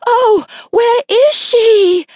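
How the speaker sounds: loud, high, long-drawn wail; frantic wail. A narrator's reading. Mother desperate to find her child, wailing frantically.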